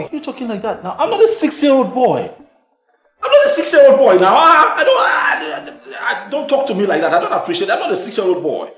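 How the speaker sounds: high, loud, laughing outrage; protest, half laughing, half furious; indignant shout. Acted. [name] protesting to Kemi that he's no child.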